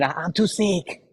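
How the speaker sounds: slow, drawn-out mock complaint; mock whiny excuse. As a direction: imitate a lazy person whining about being sick. Mocking an excuse for poor health.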